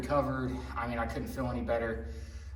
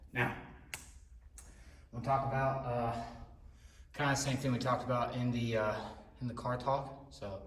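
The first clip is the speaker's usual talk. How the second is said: out of breath; 6 dB quieter than usual. Real, not acted. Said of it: "Sorry, I'm still catching my breath".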